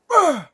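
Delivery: sharp loud gasp, high in the voice; a loud, high, choking gasp. Retold or imitated. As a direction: suck in air like surfacing from drowning. Waking up gasping for air.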